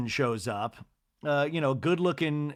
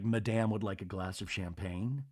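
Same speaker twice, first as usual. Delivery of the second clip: low formal butler voice; 6 semitones lower, 8 dB quieter than usual. Retold or imitated.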